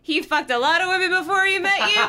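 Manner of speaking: hammed-up loud aunt; crude aunt. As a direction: blurt it out with relish. Reading Catherine's crude line.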